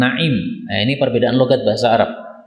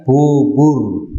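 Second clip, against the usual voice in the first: East Javanese. Retold or imitated. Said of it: "Jawa Timur mengatakan".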